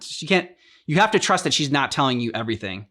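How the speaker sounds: usual speaking voice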